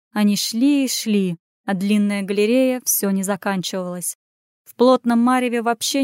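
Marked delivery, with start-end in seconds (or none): none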